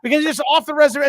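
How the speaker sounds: normal talking voice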